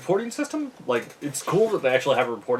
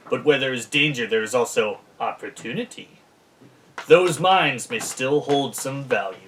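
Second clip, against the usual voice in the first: dramatic reading; 4 dB louder than usual. Acted. Reads flavor text.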